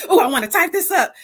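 acted-out burst of eagerness; eager outburst. Host acting out her instant urge on getting a word, eager.

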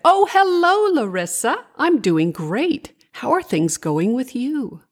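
cheery storybook moth, high on the greeting; a hint of a chirpy moth character; lightly voiced friendly moth. A narrator's reading.

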